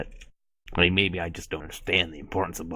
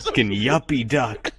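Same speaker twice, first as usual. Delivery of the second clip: laughing; 6 semitones higher, 5 dB louder, slower than usual. Real, not acted. Laughing insult.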